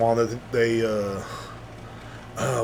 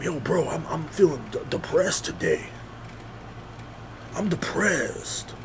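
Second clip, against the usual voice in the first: soft, slowing mock sad confession; mock depressed voice; 4 semitones higher, 7 dB quieter, slower than usual. Man confessing to friends he's depressed, softly mocked. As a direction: play the guy moping out his feelings.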